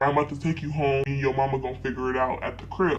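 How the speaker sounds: low, steady father voice; imitated dad. Dad telling his daughter the plan, low and steady. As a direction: drop the pitch into a cool dad.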